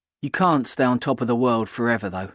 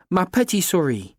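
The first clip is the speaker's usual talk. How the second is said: put-on higher-pitched French endearment in French accent; 5 semitones higher than usual. A narrator's reading. Kat playing French to the narrator in the crowd, in a French accent. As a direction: coo it in a lifted French accent. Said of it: "in a perfect French accent".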